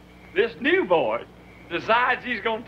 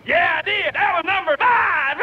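high, loud crowing; gleeful comeback; 8 semitones higher, 5 dB louder than usual. Real, not acted. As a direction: crow the callback, about to burst out laughing. Gleeful callback to the number joke.

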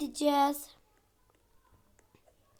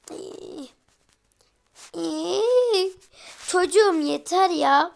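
frustrated whine; 3 semitones higher, 7 dB louder, slower than usual. Real, not acted. Baby keeps crying, frustrated.

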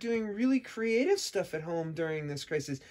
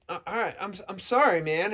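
ordinary talk, nothing marked

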